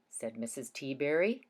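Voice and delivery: ordinary delivery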